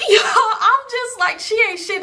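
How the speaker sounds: high, laughing incredulity; laughing disbelief. Real, not acted. Storyteller laughing at a disloyal friend, high incredulous voice.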